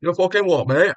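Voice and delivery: a mock chav British accent, squaring up. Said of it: "either stereotypical chav British, where it's like".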